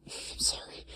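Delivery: a quick unvoiced aside; whispered sorry. Real, not acted. Reader whispers an apology to listeners, under breath.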